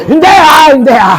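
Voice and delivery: high, loud objection that falls away; mock protest shout. Shown as a demonstration. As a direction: demonstrate shouting a refusal. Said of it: "pwede mo ring isigaw".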